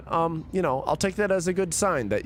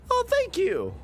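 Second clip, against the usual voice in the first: delighted; slower than usual. Real, not acted. Delighted by a viewer's gift.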